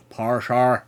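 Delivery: "Parshar" punched loud in Northern Irish; Northern Irish accent. Shown as a demonstration. Streamer showing a Northern Irish key word, loud Northern Irish accent. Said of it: "key words to get you into be able to do a Northern Irish accent".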